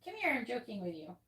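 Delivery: ordinary talk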